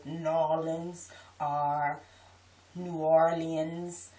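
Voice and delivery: "New Orleans" as slurred N'Awlins, then fully spelled-out New Or-lee-uns; drawn-out outsider versions of "New Orleans"; disliked pronunciations. Shown as a demonstration. Local listing how outsiders say the city, mock mispronouncing "New Orleans". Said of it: "I hate when people say".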